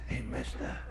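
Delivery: whispered pestering from a hiding place; very soft, furtive whisper; secretive whisper. Acted. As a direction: whisper so nobody outside hears. Kid whispers while hiding in closet.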